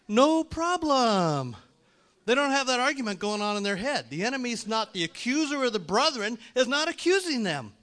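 loud, high full-force preaching; impassioned preaching. Acted. Preacher to congregation on the accuser's attacks, impassioned. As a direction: drive it home, pitched high and loud.

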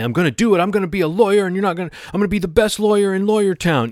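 loud, high, defiant outburst. Retold or imitated. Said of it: "Jimmy saying defiantly".

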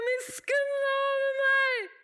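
high, drawn-out plea for mercy; long desperate-sounding holler; crying out. Acted. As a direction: wail it to heaven at full voice. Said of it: "Og du roper".

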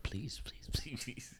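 whispered pleading. Pretend. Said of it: "They're like".

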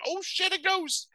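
very high, slow mock shriek; mock fright. Dogs spotting the ghost, high drawn-out mock fright. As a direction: squeal it in hammed-up terror.

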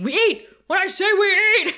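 bossy shout. Retold or imitated. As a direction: snap out the order like a boss. Vampire leader bossing her lackeys.

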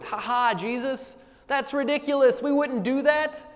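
high, loud amused crowd voice scoffing; mock laughing listener. Preacher voicing ancient listeners laughing at Jesus, high and amused. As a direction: play the crowd laughing off an absurd idea.

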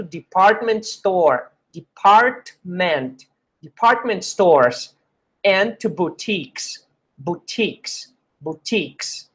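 department said slowly, syllable by syllable; slow model reading. Shown as a demonstration. Teacher modeling department stores slowly. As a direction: stretch out department for the class. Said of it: "teacher models department slowly".

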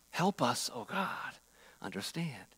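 soft, fading plea to God; hushed prayer. Retold or imitated. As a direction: pray it quietly, almost breaking off. Preacher prays a humble plea.

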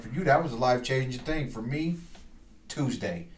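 quiet understated delivery, dropping away at the end; quoted movie villain. Retold or imitated. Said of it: "one of my favorite movie lines".

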